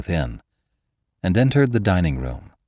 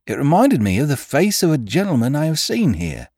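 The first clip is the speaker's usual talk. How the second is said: high, deferential servant voice; a nervous old gardener pleading; humble gardener voice; 8 semitones higher, 4 dB louder than usual. A narrator's reading.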